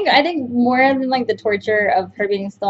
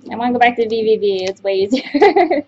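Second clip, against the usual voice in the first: normal talking voice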